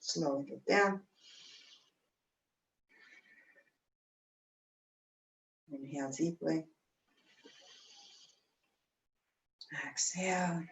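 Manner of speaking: quiet, breathy guiding voice; barely voiced after fast breathing; soft breathy cues. Real, not acted.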